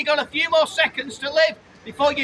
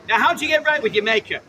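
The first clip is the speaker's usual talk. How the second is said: ordinary talk, nothing marked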